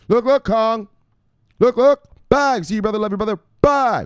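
high, loud catchphrase shouting; hype shoutout. Acted. Streamer shouting out a viewer, loud and high. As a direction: fire up the chat with each shoutout.

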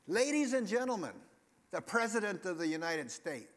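loud, high-pitched announcer proclamation; imitated ceremonial announcer. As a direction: announce the head of state to a packed room.

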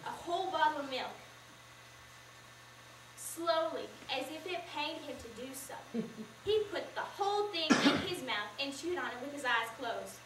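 usual speaking voice

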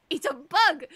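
loud, startled shout of a bug sighting; loud alarmed character shout; alarmed cry. Acted. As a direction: yell it out in alarm.

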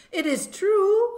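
high, loud and slow protest; cried out. A narrator's reading. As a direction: cry it out so they stop laughing. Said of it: "I cried".

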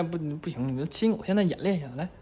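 ordinary talk, nothing marked

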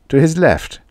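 ordinary delivery

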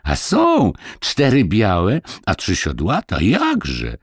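put-on high, lively peasant boy explaining; a small village boy telling a tale; little boy Kuba. A narrator's reading. Boy proudly answering.

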